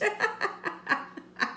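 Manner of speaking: high airy giggle; breathless high-pitched giggle; laugh. Real, not acted. Laughing at own joke.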